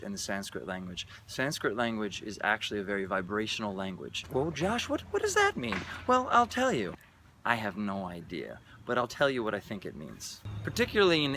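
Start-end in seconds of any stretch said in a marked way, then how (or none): 4.3-5.8 high-pitched mock listener; curious questioner. Audience member asks Josh what vibrational language means, high mock voice. 6.1-7.0 high-pitched put-on reply; mock eager answer. Host answering his imagined questioner, high mock-eager voice.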